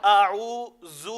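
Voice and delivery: "اعوذ" mispronounced with a buzzing Z, a-oo-zoo; drawn-out "اعوذ" ending in a firm zoo; hard Z. Shown as a demonstration. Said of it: "زا جو ہوتا ہے وہ سختی ہوتی ہے".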